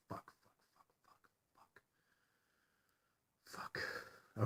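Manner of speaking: whispered curses. Real, not acted. To himself before ending the video, whispering curses. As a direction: hiss the curses under your breath.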